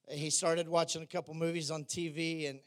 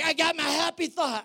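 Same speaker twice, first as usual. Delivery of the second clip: excited realisation; 11 semitones higher, 7 dB louder than usual. Retold or imitated. Peter Pan finds his thought.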